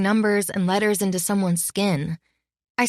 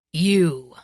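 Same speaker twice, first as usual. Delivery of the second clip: snarl; 4 dB louder than usual. A narrator's reading. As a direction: spit the word out with light contempt. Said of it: "he said with a snarl".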